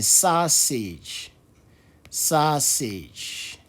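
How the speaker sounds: SAUSAGE stretched out over two long syllables; slow word demonstration. Shown as a demonstration. Teacher modelling a new word, slow and drawn out. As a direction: draw out SAUSAGE slowly, syllable by syllable.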